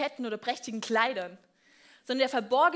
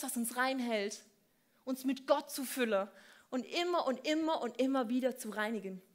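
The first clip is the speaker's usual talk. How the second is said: normal talking voice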